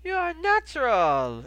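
put-on high, loud, drawn-out praise in a foreign accent; accented innkeeper crowing approval; amused exclamation. A narrator's reading. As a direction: exclaim it slowly with delight. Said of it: "exclaimed Goldie with a hint of amusement".

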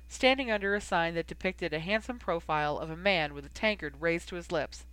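ordinary delivery, nothing marked

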